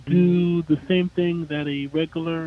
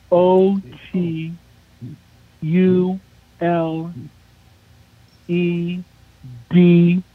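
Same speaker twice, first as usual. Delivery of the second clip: slow letter-by-letter spelling; spelling it out slowly; slower than usual. Real, not acted. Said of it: "a little slower".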